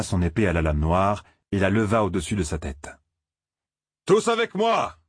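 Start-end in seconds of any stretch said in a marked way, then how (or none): 4.1-5.0 high-pitched battle shout; rallying cry. A narrator's reading. Leader rallies his group to charge, crying out high. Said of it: "cria l'âne".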